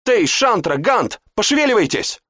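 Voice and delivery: high urgent call; urgent shout. A narrator's reading. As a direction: hurry the friends. Lara urges friends.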